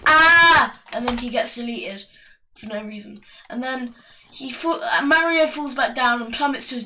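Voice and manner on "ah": high, loud cartoon "ah"; Goomba's scream. Goomba screams before being deleted, loud mock scream. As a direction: scream briefly, pitched up. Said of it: "the Goomba just screams".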